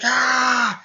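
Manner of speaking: high, loud held "ah"; imitated battle yell. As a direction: yell like an attacking anime fighter.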